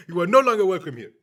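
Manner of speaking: high, very loud hammed-up shout; mock furious father. Father throws son off the property, mock furious shouting. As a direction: bellow it like an outraged landowner.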